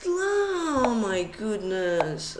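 very slow, long falling wail of exasperation; drawn-out dismay. Real, not acted. Streamer despairing after the misplayed move, drawn-out dismay. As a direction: stretch every word, sliding downward.

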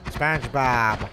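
slow drawn-out cartoon voice; lightly voiced cartoon character; SpongeBob voice. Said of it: "do you think I can do the voice".

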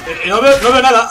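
loud, rising alarm; panicked outburst. Real, not acted. Gamer suddenly blinded in a fight, panicked outburst. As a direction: raise pitch and volume as panic builds. Cannot see during combat.

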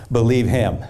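low, solemn voice of God speaking down; voice from heaven. Acted. Voice from heaven quoted.

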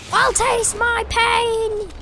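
slow, high hammy villain cry; villain taunt. Pretend. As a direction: ham up a cartoon villain taunt. Gamer taunting enemies.

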